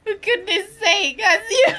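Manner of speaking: exasperated laugh. Real, not acted. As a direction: laugh through it.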